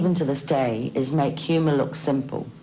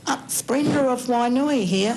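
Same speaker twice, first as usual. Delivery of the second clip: higher, chirpy suburban caller voice; talkback caller; 8 semitones higher than usual. Retold or imitated. Caller ringing talkback radio, higher chirpy voice. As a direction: do a sing-song radio caller. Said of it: "people would ring the talkback and say".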